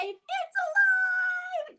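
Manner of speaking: high, thrilled cry of surprise; excited shout. Pretend. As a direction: burst out with delight as the bees appear. Fingerplay reveal, shouted.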